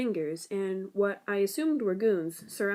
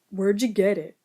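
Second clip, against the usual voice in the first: demanding; 6 dB louder than usual. Acted. Goon demands the computer.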